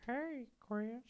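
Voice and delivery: put-on high, creepy old-man impersonation; old man from Family Guy. Greeting Chris as the cartoon old man, high old-man voice. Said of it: "old man from Family Guy".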